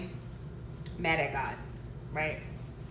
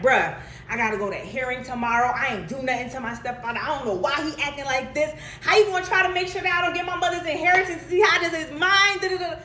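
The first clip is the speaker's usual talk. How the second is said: beefing with God; 5 semitones higher, 4 dB louder than usual. Retold or imitated.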